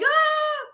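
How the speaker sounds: short high yelp of fright; a sudden squeal at a spider in the face; startled scream. Retold or imitated. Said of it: "I'm like".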